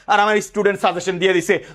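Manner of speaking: ordinary talk, nothing marked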